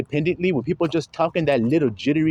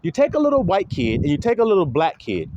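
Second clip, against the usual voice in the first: ordinary talk, nothing marked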